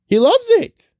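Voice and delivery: very high, loud burst of excitement; gleeful gushing. Real, not acted. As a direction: gush it out in a sudden high squeal. Gushing over Carl's joy as adventurer.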